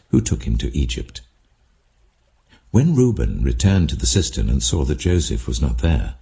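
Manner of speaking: ordinary delivery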